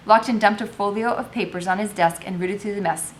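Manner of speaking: ordinary delivery, nothing marked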